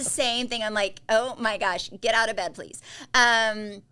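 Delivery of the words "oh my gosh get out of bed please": louder exasperated plea; half-joking nagging, pushed louder. Retold or imitated. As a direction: get out of bed, come on, mock fed-up. Scolding mom on phone.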